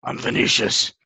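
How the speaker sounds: a lightly gruff father saying Hi, I'm Vinicius; hint of a stern father; butch dad voice. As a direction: play a blokey dad. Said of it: "this is Chloe's father over here".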